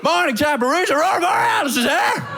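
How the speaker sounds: high, full-throated holler; shouted wake-up call. Acted. British man waking the Danish family, high shouted call. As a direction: blast them out of bed with it.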